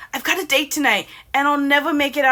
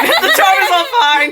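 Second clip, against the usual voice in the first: laughing; 9 semitones higher, 9 dB louder than usual. Real, not acted. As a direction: repeat the line through laughter. Repeating her own flubbed line.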